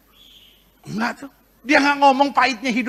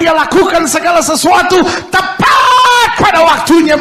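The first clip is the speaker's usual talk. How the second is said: full force preaching; 6 semitones higher, 6 dB louder than usual. Acted. Preacher proclaiming faith to the congregation, shouting. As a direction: preach it out loud with rising conviction.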